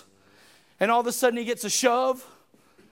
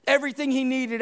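normal talking voice

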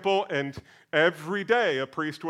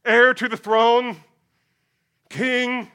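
loud, higher, slow acclamation; crowd acclaiming; 6 semitones higher, 8 dB louder than usual. Acted. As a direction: shout praise as the crowd. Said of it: "the crowds declared".

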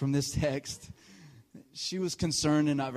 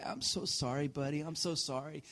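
softer, understated tearful apology; mild retold remorse, hushed; 6 dB quieter than usual. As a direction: apologize gently, as if close to tears. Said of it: "I've got tears running down my face".

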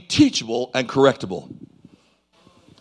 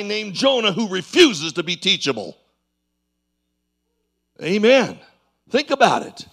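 fervent sermon storytelling; 7 semitones higher than usual. Acted. Preacher retells Jonah to the church: heated preaching. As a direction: paint the sinking ship with urgency.

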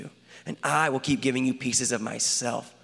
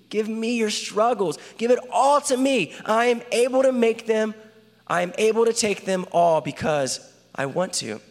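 higher and louder, pleading with rising urgency; impassioned plea; 7 semitones higher, 3 dB louder than usual. Acted. God urging the listener to give him their struggles, rising, louder plea. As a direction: reach out and beg them to hand it over.

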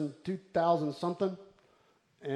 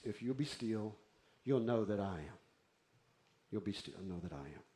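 low, soft, nearly murmured; hushed divine word; 8 semitones lower, 10 dB quieter than usual. Acted. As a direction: let it sink to a murmur. Voices God's whispered word.

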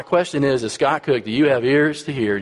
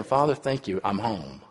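softer, fading thanks to God; quiet relieved prayer; 3 semitones lower, 7 dB quieter than usual. Retold or imitated. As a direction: whisper your relief at coming home. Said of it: "I remember saying".

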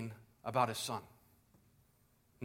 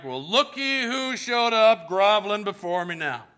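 smug sneer; 4 semitones higher, 5 dB louder than usual. Retold or imitated. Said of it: "arms crossed and like".

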